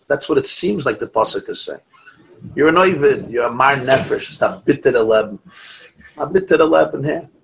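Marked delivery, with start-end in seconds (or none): none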